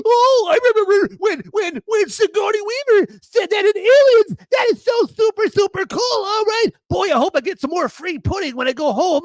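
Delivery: very high, loud, gushing simpleton voice; mock moron fan. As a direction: gush like a dim-witted fanboy in a silly squeal. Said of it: "you're gonna be like".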